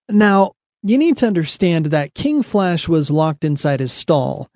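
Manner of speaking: plain reading voice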